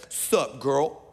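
put-on low, smooth "Sup, girl?"; Antoine's smooth line.

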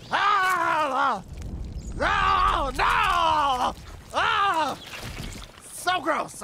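high, loud shrieks of disgust; shrieking no, no, grossed out; disgusted screams. Real, not acted. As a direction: scream out in real revulsion. Grossed out by game scene.

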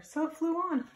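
ordinary delivery

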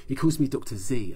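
usual speaking voice

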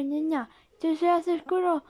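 ordinary talk, nothing marked

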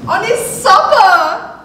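ordinary delivery, nothing marked

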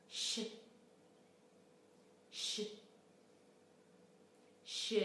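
a long voiceless shush for ش; shushing sh sound. Shown as a demonstration. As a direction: shush the ش alone, softly. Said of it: "صدای بعدی".

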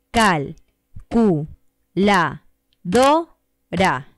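calculadora beaten out as Cal, cu, la, do, ra; syllable count. Shown as a demonstration. Teacher counting syllables of calculadora aloud. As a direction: tap Cal, cu, la, do, ra, one beat each. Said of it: "cuántos golpecitos de voz hay".

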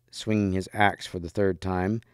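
plain reading voice

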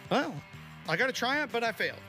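ordinary talk

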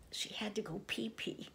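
secret little whisper; whispered potty joke. Acted. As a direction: hush it like a cheeky secret. Potty joke in kids' story.

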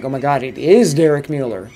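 retold amazement, loud punch on IS; loud, thrilled realization; amazed recognition. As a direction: recount it astounded, leaning hard on "is". Retells amazed recognition of a celebrity.

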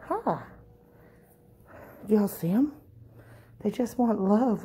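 ordinary delivery

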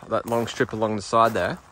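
ordinary talk, nothing marked

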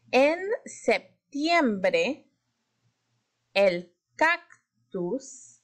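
En septiembre, el cactus stretched slowly, the k sound stressed; stretched emphasis. Shown as a demonstration. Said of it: "levanten la mano si escuchan".

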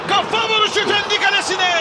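shouted goal call; screaming football commentator. Real, not acted. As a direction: yell the own goal.

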